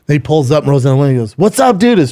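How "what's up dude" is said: higher-pitched casual hello, retold; upbeat quoted greeting; imitated friendly greeting. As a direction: imitate a buddy calling out hello from his car. Retold greeting from the tailing driver.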